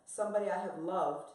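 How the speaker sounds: usual speaking voice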